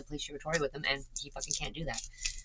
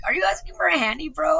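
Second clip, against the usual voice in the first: incredulous retort; 14 semitones higher, 10 dB louder than usual. Retold or imitated. Retelling her reply on the bus.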